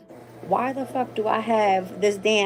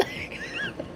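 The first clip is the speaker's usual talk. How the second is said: airy squeal of laughter; squeaky high laugh; 10 dB quieter than usual. Real, not acted. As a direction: break into a high giggle. Laughs at own wail.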